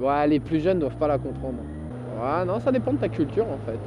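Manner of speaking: ordinary talk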